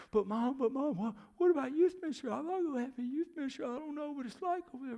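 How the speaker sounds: put-on high-pitched, rushed pleading of a crying boy; imitating tearful child. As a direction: plead with Mom in an acted tearful wail. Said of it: "burst out in tears".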